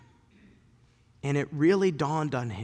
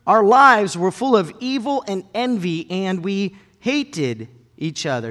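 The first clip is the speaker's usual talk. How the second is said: slow, louder, solemn reading; scripture reading; 6 semitones higher, 8 dB louder than usual. Acted. Preacher reading Paul's letter to Titus, solemn. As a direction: read it with gravity.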